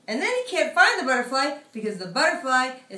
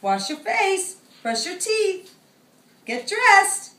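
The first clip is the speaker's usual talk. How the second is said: higher, louder mother hen orders; bossy mom; 9 semitones higher, 7 dB louder, slower than usual. Acted. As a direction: rattle off the morning orders. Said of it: "Big Chicken says".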